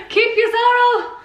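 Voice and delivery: high, slow, hammed-up rebuke to the bird; mock defiant. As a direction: tell the magpie off with dramatic flair. Addressing a single magpie.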